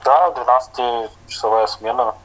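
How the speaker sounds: normal talking voice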